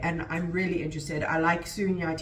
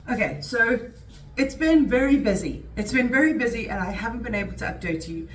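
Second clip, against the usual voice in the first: usual speaking voice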